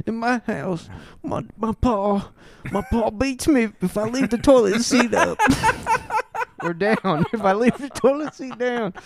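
put-on high, laughing voice of a scared little boy; a frightened boy confessing, breaking into giggles; frightened kid. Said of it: "he starts crying".

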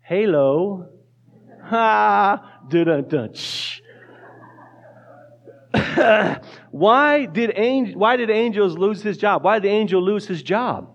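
ordinary talk

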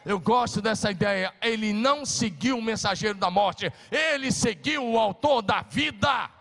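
loud, high preaching that builds to a shout; fiery preaching. Acted. Pastor preaching at full force.

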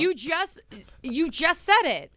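yelling. Real, not acted. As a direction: scold emphatically, pitch pushed up. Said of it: "I'm, like, yelling at you".

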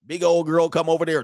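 normal talking voice

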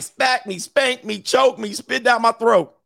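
high-pitched mock frantic urging; hammed-up high excited commands; eager demanding. Acted girl making eager demands.

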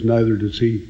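dry deadpan. Retold or imitated. Arranger answering the singer, dry deadpan. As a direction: toss it off bone-dry. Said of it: "Marion has this dryness".

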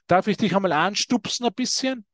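normal talking voice

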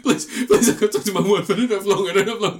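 high, loud, laughing plea; desperate pleading. Retold or imitated. Dead man begs to call his wife, laughing high plea. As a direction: beg frantically while cracking up.

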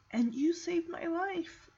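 soft, slow, high and emotional; slow down and soften, moved by the moment; choked up. Real, not acted. Fan retells boy thanking dog, choked up. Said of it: "I'm getting choked up".

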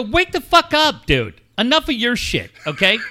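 high-pitched, exasperated scolding; heated retold telling-off; retold laying-into. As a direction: re-enact tearing into your son. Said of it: "So I lay into him".

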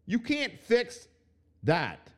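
high, slowing emphatic no; emphatic retort. Real, not acted. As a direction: spell it out, slow and pointed. Retorting to the imagined coach.

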